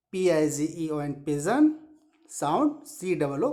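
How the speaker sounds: ordinary talk, nothing marked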